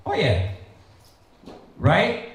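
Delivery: ordinary talk, nothing marked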